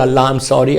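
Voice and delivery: low, soft murmured apology; hushed repentance. Shown as a demonstration. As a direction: show a private word of apology to God. Models a private apology to God. (دھیمی آواز میں معذرت)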